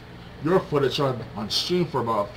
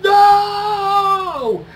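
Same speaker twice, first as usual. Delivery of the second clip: high, drawn-out mock crowd scream; over-the-top screamed disbelief, stretched way out; imitated screaming reaction; 8 dB louder, much slower than usual. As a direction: wail it out long like a stunned fan. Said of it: "Everyone was screaming their reactions like".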